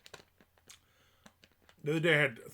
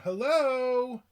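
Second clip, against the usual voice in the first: very high, loud, drawn-out call; mock her insistent calling through the door; imitated caller; 11 semitones higher, 8 dB louder than usual. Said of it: "She kept going".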